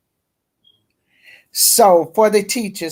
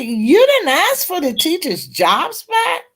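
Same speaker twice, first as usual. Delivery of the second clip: incredulous judge; 10 semitones higher than usual. Retold or imitated. Retold judge questioning the attorney.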